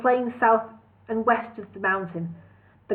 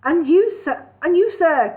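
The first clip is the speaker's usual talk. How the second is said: breathless, higher and louder; gasping; 7 semitones higher, 6 dB louder than usual. Acted. Bingo gasping greetings to comrades, utterly exhausted and breathless. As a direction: gasp the words out, near collapse. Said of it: "gasped Bingo".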